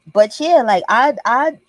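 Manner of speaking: ordinary talk, nothing marked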